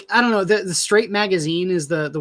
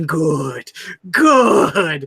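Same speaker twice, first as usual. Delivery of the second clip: gloating schemer; 5 dB louder than usual. Acted. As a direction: relish each word like a hand-rubbing villain. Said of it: "rubbing my hands together, going".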